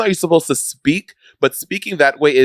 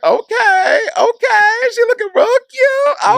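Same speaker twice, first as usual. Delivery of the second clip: very high, wailing "okay"; way up high, stretched and over the top; high-pitched mock squeal; slower than usual. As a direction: squeal it out like a sarcastic cheer. Mock squealed reply, far higher pitched.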